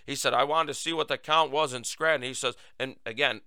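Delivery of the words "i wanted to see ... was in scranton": ordinary talk, nothing marked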